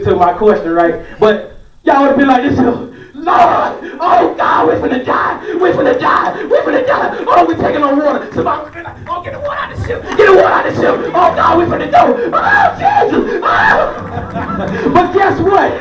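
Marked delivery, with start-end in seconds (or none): 2.6-14.1 high panicked shouting, bailing water; hysterical. Retold or imitated. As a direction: scream for help as the boat sinks. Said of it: "y'all have been hysterical".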